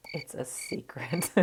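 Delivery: hushed, teasing secret; playful whisper. Pretend. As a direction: whisper it like a coy tease. Teasing about her foundation shade.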